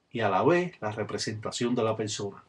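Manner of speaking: normal talking voice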